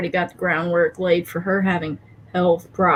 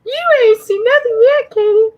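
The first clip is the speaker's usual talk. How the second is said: excited boast; 12 semitones higher, 6 dB louder, faster than usual. Real, not acted.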